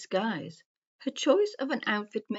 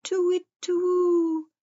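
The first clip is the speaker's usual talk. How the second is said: owl hoot; 12 semitones higher than usual. A narrator's reading. Old wise owl calls over the woods, hooting. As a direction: hoot it like an owl, long and high. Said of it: "he hooted".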